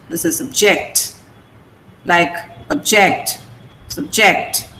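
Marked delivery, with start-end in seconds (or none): 0.4-1.2 subject said sub-JECT, sub reduced; stress on second syllable. Shown as a demonstration. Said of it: "ject is more pronounced than sub". 2.7-4.7 object, subject said slowly as ob-JECT, sub-JECT; stress on second syllable. Shown as a demonstration. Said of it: "ject is more pronounced than sub".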